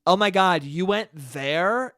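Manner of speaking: imitated awe, drawn out at the end; mock impressed. Comedian imitating woman's tone about a fancy bar, mock impressed. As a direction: stretch it out as if it's a very fancy place. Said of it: "by the tone of her voice".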